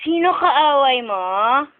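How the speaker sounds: slow, stretched-out question; drawn-out questioning. Real, not acted. Parent asks baby who the enemy is, drawn out. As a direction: draw the question out slowly.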